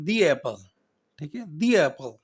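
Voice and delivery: "the apple" run together as one word, no break; linked "the apple". Shown as a demonstration. Teacher shows "the apple" said as one unit. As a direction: say "the" and "apple" joined smoothly. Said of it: "اس کو اکٹھا ہی پڑھنا ہے".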